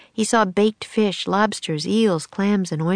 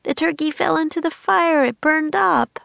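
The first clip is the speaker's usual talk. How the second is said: put-on high-pitched urgent girl voice; raised, anxious young girl; girl character's alarm; 11 semitones higher, 3 dB louder than usual. A narrator's reading. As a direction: report the mishap in a high, worried child's voice. Annie voiced reporting the burned turkey.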